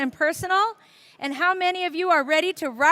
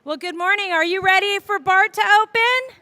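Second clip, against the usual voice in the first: higher, louder rousing greeting; rallying the crowd; 4 semitones higher, 5 dB louder than usual. Real, not acted. Chair opening the station ceremony, rallying the crowd. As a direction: whip up a cheer from the audience.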